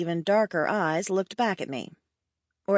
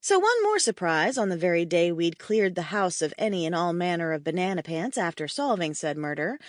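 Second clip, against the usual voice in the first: ordinary delivery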